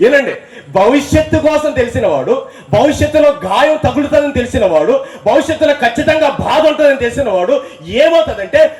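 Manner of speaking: high, building sermon voice; fervent preaching. Acted. Preaching crescendo.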